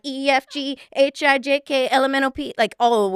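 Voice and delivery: mock frantic listing; racing girl brain. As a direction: reel off the alphabet like it never stops. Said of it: "We're like".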